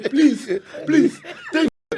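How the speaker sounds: high, laughing fake pleading; mock begging. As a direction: ham up a desperate plea while laughing. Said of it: "That's how he, that's how he was talking".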